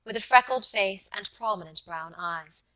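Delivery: usual speaking voice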